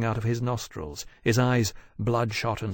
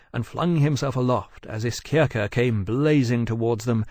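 ordinary delivery, nothing marked

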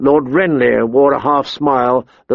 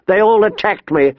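put-on high, aggrieved young prince; insistent; 7 semitones higher than usual. A narrator's reading. Prince accusing the girl before the king, insistent. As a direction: press the accusation in a higher voice. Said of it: "Prince Joffrey insisted".

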